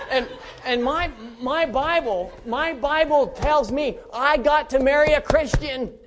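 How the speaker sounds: loud, high, slowly drawn-out declaration; slow, emphatic preacher's punchline; hammed-up insistence. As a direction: proclaim it with mock solemnity for laughs. Comic insistence on his Bible rule.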